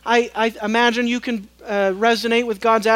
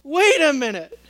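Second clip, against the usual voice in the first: mock protest; 5 semitones higher, 5 dB louder than usual. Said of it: "would be apt to say".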